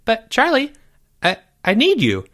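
put-on high, halting little-boy plea; squeaky, needy voice; pleading boy. A narrator's reading. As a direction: beg your friend, stumbling on the words. Jeffrey begs his reluctant friend.

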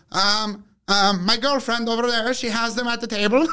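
imitating Gleb. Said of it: "And he goes".

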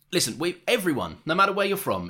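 ordinary talk, nothing marked

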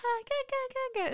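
put-on high, soft baby giggle and gurgle; Teletubbies sun baby. Imitating the Teletubbies sun coming out, baby gurgle. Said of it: "like the Teletubbies when the sun comes out".

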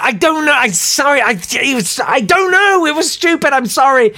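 high-pitched, panicky excuses; re-enacted mortified babbling; flustered apology. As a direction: stumble over yourself apologising. Prankster flustered before angry victim.

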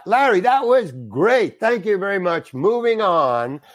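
high, drawn-out wrap-up call; retold director's praise. Director praising Larry after the take, calling out. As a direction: announce it to the set, slowing at the end.

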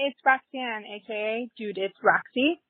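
normal talking voice

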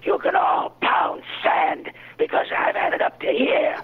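hissing, breathy voice of a cynical, arrogant demon who snaps into a shout; hateful demon. Acted. Improv prompt for a hateful demon, hissing contempt. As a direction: sneer every word like a demon who loves deceit. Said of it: "A, a, a hateful demon?".

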